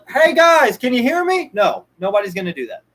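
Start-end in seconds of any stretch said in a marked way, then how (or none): 0.1-1.5 high, loud call to distant listeners; calling out across the room. Pretend. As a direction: shout to be heard from far off. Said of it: "you gotta be like".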